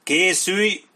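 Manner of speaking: high, loud readiness order; drawn military call; shouted command. A narrator's reading. Battle command to raise muskets.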